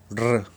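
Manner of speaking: ル with a rolled R; rolled R sound. Shown as a demonstration. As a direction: trill the tongue on ル. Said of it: "これは巻き舌". (巻き舌の「ル」)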